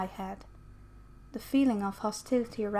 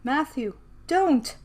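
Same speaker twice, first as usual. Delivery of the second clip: higher, louder cry on "don't"; urgent plea; 4 semitones higher, 4 dB louder, slower than usual. Acted. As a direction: call out to stop him, second word punched. Calling out to stop Matthew.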